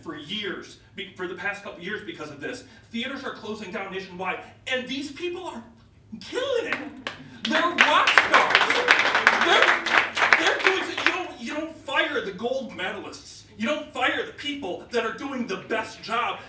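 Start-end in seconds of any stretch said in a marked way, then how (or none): 4.7-16.4 impassioned outburst. Real, not acted. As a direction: voice soaring high and loud. Passionate plea, voice rising loud.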